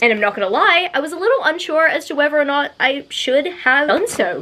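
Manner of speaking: ordinary talk, nothing marked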